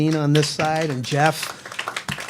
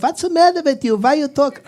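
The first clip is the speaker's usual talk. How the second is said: put-on dad's Polish accent; 13 semitones higher, 4 dB louder than usual. Son starts talking like his dad at home, high Polish accent. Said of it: "my voice would change".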